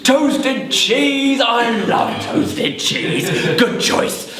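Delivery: high, gleeful exclamation with laughter; delighted goblin. Acted. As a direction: relish the choice with acted delight. Goblin delighted by the food choice.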